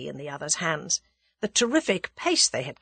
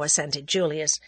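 ordinary delivery

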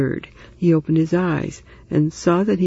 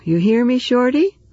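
raised, high call to the next room; 7 semitones higher than usual. Acted. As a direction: call out to him in the other room. Calling to man in next room.

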